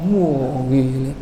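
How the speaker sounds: ordinary talk, nothing marked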